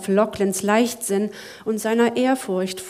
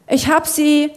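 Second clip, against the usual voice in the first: higher, louder call of success; triumphant call; 6 semitones higher, 6 dB louder than usual. Acted. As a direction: call out the find. Zack calls out triumphant.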